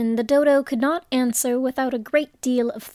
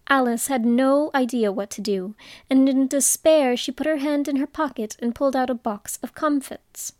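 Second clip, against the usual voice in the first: usual speaking voice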